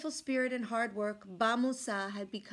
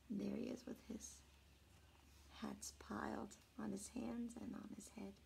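soft unvoiced aside; hushed aside to listeners; whisper; 16 dB quieter than usual. Real, not acted. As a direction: whisper while pointing at the picture. Aside while showing picture.

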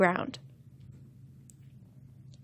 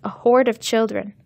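ordinary delivery

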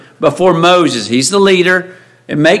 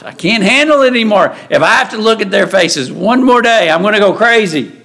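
higher, quick fed-up venting; acted end-of-my-rope complaint; exasperated burnout; 4 semitones higher than usual. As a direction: vent like a worker at breaking point. Voices a burnt-out worker's complaint.

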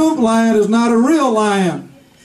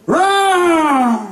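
lion roar; 4 semitones higher than usual. Acted. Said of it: "And he would be like".

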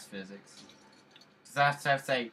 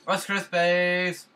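louder catchphrase; 6 semitones higher, 8 dB louder, much slower than usual. Pretend. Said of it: "And again!".

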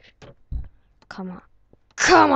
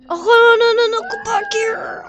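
panicked; 12 semitones higher, 4 dB louder than usual. Real, not acted. Character slipping away.

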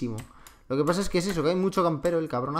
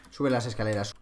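ordinary delivery

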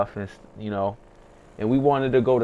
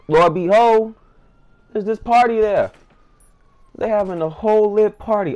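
higher and louder, building wonder; pitched-up awe; amazed telling; 7 semitones higher, 6 dB louder than usual. A narrator's reading. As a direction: announce it like you can't believe it. Narrator amazed at moon party.

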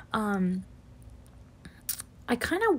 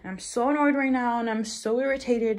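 usual speaking voice

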